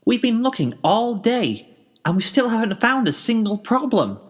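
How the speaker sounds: a cheerful mare a bit baffled; Pinkie, puzzled. A narrator's reading. Pinkie telling Spike the search found nothing, puzzled.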